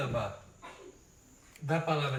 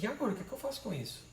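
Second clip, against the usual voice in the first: quick confused aside; 4 semitones higher, 7 dB quieter, much faster than usual. Retold or imitated. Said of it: "E pega o negócio e diz".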